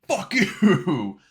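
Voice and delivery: rowdy fans remembering they hate him; mock crowd turning. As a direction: play the crowd flipping to boos. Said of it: "they're like".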